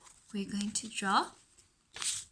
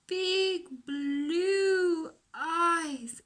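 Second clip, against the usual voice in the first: drawn out slowly; 6 semitones higher, 5 dB louder, much slower than usual. Shown as a demonstration. Teacher stretches words drawing.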